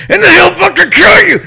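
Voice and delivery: high, loud threatening shout; Joker threat. Shown as a demonstration. Host imitating the Joker's sudden murderous threat, shouted. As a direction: scream the threat like a madman. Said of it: "he gets very fucking serious".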